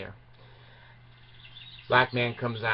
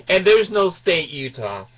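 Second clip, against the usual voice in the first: callback punchline; 4 semitones higher, 5 dB louder than usual. Real, not acted. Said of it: "callback joke".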